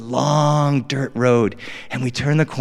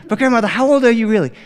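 put-on pleading kid; 8 semitones higher, 5 dB louder, faster than usual. Kid pressing grandmother.

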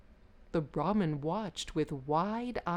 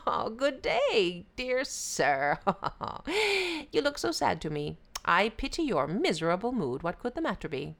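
sly, chuckling jackal voice, higher; a fawning trickster jackal; 5 semitones higher than usual. Acted. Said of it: "noticed the Brahmin walking by and said".

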